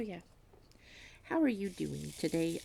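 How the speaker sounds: usual speaking voice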